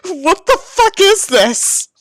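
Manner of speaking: slow, high, disbelieving; can't believe what you're reading; incredulous. Real, not acted. Reader baffled by the fic's disc golf, slow high disbelief. Reacting to absurd fic.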